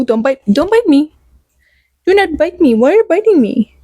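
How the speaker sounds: pet talk. Real, not acted. Owner scolding her biting cat, high pleading pet voice. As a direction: shoo the biting cat sweetly.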